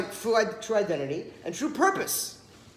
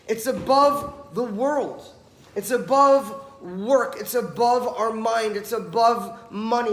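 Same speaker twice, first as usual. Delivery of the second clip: slow and louder, leaning on each above; emphatic preaching; 3 semitones higher, 7 dB louder, slower than usual. Acted. Preacher to his listeners, slow emphatic repetition. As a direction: hammer every above home. Preacher driving home the point.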